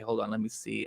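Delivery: usual speaking voice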